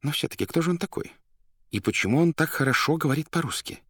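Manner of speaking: high, quick, puzzled inner voice; мысль про себя. A narrator's reading. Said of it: "а сам в это время думал". (быстрая высокая мысль про себя)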